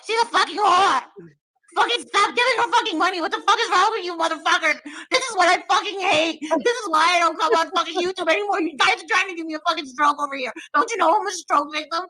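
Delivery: hammed-up furious tirade at donors; Molly ranting. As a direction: screech at viewers for giving a rival money. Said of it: "How do you do impressions so good?".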